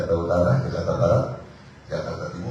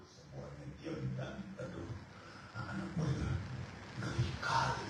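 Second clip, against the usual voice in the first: hushed; 8 semitones lower, 17 dB quieter than usual. Acted. Hush after the storm.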